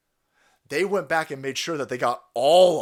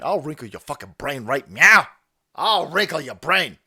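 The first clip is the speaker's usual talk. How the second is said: mock threat; 7 dB louder than usual. Ranting threat at young listeners.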